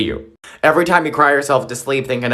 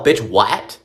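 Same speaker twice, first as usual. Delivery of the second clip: normal talking voice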